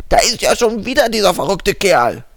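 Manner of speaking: over a landline phone. Acted. Annoyed caller on phone.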